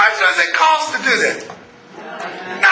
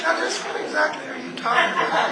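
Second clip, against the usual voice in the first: much softer, gentle question; quietly calm reply; soft calm answer; 8 dB quieter, faster than usual. Retold or imitated. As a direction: defuse the shouting with a hush. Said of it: "and you say".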